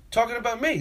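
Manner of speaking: normal talking voice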